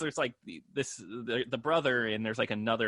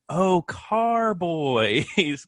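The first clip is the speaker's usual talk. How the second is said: slow realization; 4 semitones higher, 3 dB louder, much slower than usual. Retold or imitated. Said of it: "and I was like".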